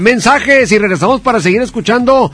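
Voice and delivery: upbeat radio sponsor read. Acted. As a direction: sell it like a radio spot. Host reads sponsor ad.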